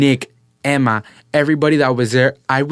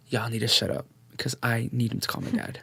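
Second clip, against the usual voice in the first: much softer, grumbled aside; muttered retold complaint; 3 semitones lower, 12 dB quieter than usual. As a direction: drop it to an under-the-breath plea. Retold aside to people talking over him.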